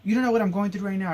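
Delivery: high, loud, fast angry outburst; going off. Retold or imitated. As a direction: blow up at her, fast and furious. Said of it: "I just went off".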